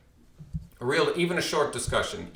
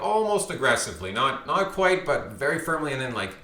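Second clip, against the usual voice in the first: ordinary delivery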